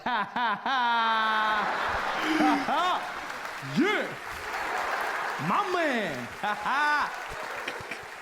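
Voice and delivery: high, drawn-out mock cool laugh; a put-on swaggering laugh; hammed-up cool-guy laugh.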